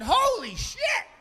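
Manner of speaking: high, slow, laughing yelp of surprise; comic shock, laughing loudly; startled shout. Retold or imitated. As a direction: jump back startled, shouting it. Startled by masked man.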